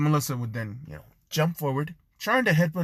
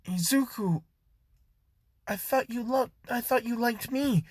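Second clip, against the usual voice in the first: hurt cry; 10 semitones higher than usual. Acted. Jean walking in on Izuku and Melissa, hurt high cry. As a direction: cry out high, feeling betrayed. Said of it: "she yells".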